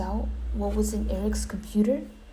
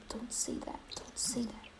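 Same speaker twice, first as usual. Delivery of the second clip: soft whisper; 12 dB quieter than usual. Pretend. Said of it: "I'm all like".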